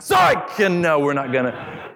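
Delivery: high playful "psych" shout; teasing dad. Pretend. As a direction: tease the kids with a sudden fake-out. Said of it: "then I'm like".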